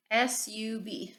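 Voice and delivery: S U B spelled letter by letter, slowly; sounding out words. Shown as a demonstration. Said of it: "you're gonna sound out the word sub".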